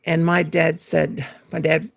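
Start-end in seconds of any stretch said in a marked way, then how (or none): none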